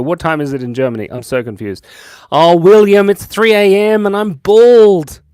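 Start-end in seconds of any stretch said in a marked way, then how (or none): 2.3-5.2 high, loud, slow mock despair; hammed-up lament; loud dramatic cry. Real, not acted. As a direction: wail it like a tragedy. Reacting to chat.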